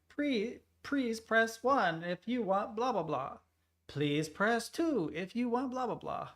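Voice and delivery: slow, high robotic menu voice; automated phone menu. Retold or imitated. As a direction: imitate a phone recording.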